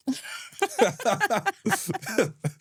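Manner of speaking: high bursting laugh; real laugh at own question; laughter. Real, not acted.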